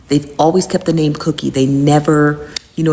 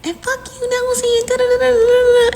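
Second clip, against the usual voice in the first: mocking Cookie. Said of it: "Cookie jumps in the box and was like".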